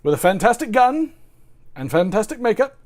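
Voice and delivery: put-on loud, high British accent; Eddie Izzard. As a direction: proclaim it in a mock British comedian voice. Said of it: "my take on the British accent".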